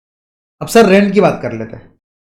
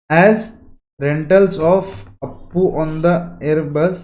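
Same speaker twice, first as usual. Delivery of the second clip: very slow, spaced-out words; measured classroom dictation pace; much slower than usual. Shown as a demonstration. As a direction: dictate slowly so students can write it down. Teacher dictates a note to write.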